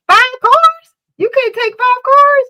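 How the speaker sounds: high-pitched, loud disbelief; incredulous outburst. Real, not acted. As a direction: squeal it in sheer disbelief. Incredulous at kids' low tolerance.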